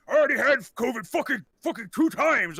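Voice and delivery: put-on old man's indignant complaining; cranky old man. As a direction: grouse like a fed-up ninety-year-old. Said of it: "he looks about ninety years old".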